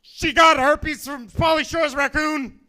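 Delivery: loud, high, slow yell; panicked blurt. Retold or imitated. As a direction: blurt it out as a drawn-out shout. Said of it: "blurted out eventually".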